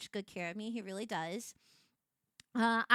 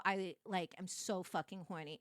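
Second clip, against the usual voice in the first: ordinary talk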